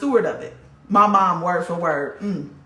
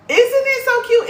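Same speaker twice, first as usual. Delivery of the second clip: very high, louder, slow coo; cooing excitement; 5 dB louder than usual. Real, not acted. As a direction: coo over something adorable. Showing the gift.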